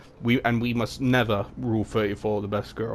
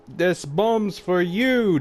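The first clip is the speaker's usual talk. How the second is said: slow, high drawn-out mock crowd yell; loud audience jeering at the contestant; mock audience scream; 9 semitones higher, 3 dB louder, much slower than usual. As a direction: stretch it out like a hammy crowd. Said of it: "the audience screamed".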